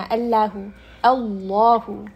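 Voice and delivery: "अल्लाहु" slow with a full, heavy lam. Shown as a demonstration. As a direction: round and fill the mouth on the lam of "अल्लाहु". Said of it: "heavy बनाते हैं उसको". ("अल्लाहु" भारी लाम)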